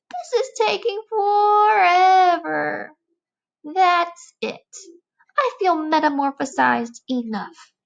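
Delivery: a bored bug stuck inside; fidgety squeaky complaints; whining caterpillar. Acted.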